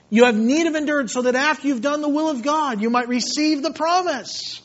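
high, loud, driving delivery; full-force preaching. Acted. Preacher urging endurance on the church, full-force preaching. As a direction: preach with mounting urgency to a ringing finish.

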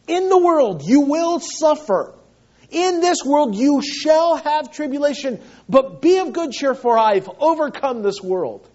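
loud, high, driving sermon voice; fervent preaching. Real, not acted. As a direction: proclaim it to the whole congregation. Preaching climax, raised and loud.

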